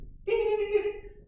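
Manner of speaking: high squealed giggle; imitated child's giggle. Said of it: "they were like this".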